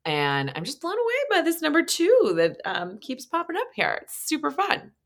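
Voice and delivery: high, giddy delight at the number; thrilled squeal of fun; excited. Real, not acted.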